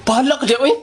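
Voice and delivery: ordinary talk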